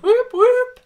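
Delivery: high, loud celebratory whoop; excited whoop. Acted. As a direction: whoop it out like a cheering fan. Reading out a celebratory chat message.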